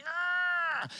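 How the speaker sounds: a high strained grunt of lifting something huge; hammed-up effort cry; mock strain grunt. Peter hoisting the hugest stone, high strained grunt. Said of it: "and he's like".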